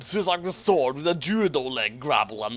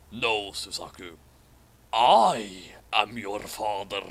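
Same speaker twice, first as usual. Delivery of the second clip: lower, slow ominous father reveal; villain reveal; 5 semitones lower, slower than usual. Acted. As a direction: deliver it like a dark lord's big twist. Villain's Vader-parody reveal.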